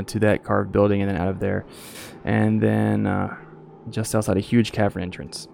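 usual speaking voice

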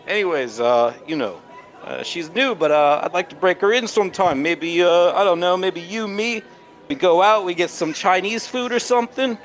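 sleazy flirt. Acted. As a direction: lay on the flattery thick and high. Marcel asking Priscilla out.

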